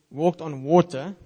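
ordinary talk, nothing marked

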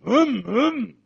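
imitated engine revs, two rising bursts; revving car. Husband imitating his wife revving the car outside, engine noise. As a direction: hum loudly like a revving engine. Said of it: "she starts reffing the car".

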